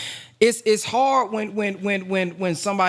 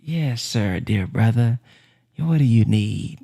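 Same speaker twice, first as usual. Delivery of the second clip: sweeten it like a patient minister; 8 semitones lower than usual. Retold or imitated. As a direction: minister gently asking a brother what he needs, tender. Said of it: "The minister gotta be".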